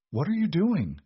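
high, alarmed cry; croaked. A narrator's reading. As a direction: blurt it out in shock. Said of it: "Margot croaked".

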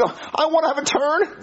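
put-on high, slow childish whine; whiny kid. Kid begging his friend for a turn, whiny. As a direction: beg for a turn like a little kid.

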